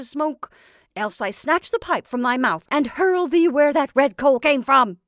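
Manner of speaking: bully your creation with loud, emphatic threats; threatening old witch. Acted. Witch threatens the Scarecrow to make it puff, loud scolding.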